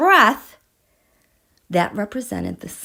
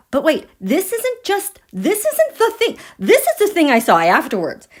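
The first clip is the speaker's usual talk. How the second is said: much higher and rising to louder, eager; excited; 7 semitones higher, 4 dB louder than usual. Real, not acted. Telling viewers about her new find, really excited. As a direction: burst in with real excitement. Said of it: "I'm getting excited".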